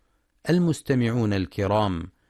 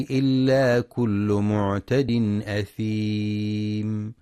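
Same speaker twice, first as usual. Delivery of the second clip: slow, drawn-out tajweed chant; Quran recitation; 3 dB louder, slower than usual. Acted. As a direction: recite with measured, melodic stretching. (تلاوة مجودة متأنية)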